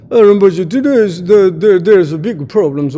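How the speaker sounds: loud excited telling of a theft; excited report. Retold or imitated. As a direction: burst in with big alarming news. Informant reporting robbery to teacher.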